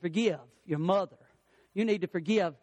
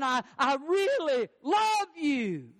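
high, slow, emphatic declaration; meaning it, heartfelt; 6 semitones higher, much slower than usual. Retold or imitated. Father's second try at convincing his son, heartfelt. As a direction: stretch out the love with conviction. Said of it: "tell him like you mean it".

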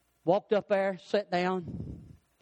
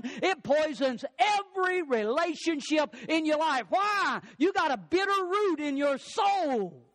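high, drawn-out preacher's cadence; full-force preaching; 5 semitones higher than usual. Acted. Preacher warning the congregation about bitterness, high-pitched preaching. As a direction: preach it out with mounting intensity.

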